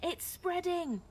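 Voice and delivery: high, drawn-out alarm; shouted. A narrator's reading. Villager warning of fire, shouted alarm. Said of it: "shouted someone".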